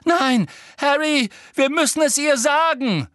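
high, loud, pleading; desperate cry. A narrator's reading. Hermine begging Harry to tell Umbridge, high and loud. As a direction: beg him in panic.

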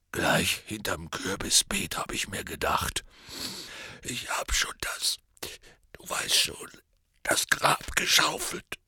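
hushed, choked whisper; tearful whisper. Acted. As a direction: whisper it through grief. Grieving Hagrid whispers.

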